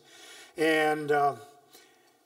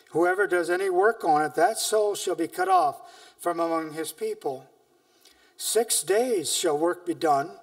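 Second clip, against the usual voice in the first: a slightly louder, measured reading of scripture; 4 dB louder than usual. Acted. Preacher reading Exodus to his church, solemn and measured. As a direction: read the Lord's words with weight to the church. Reads Exodus aloud to congregation.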